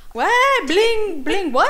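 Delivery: high, loud bursts of astonishment; exclaimed disbelief. Retold or imitated. Mother acting out her surprise at Bling Empire, high and loud. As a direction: fire out startled little exclamations.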